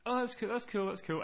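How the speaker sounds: soft, light agent voice; casual filler reply. Retold or imitated. Call centre agent filling silence, soft and casual. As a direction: toss it off quietly, a little high.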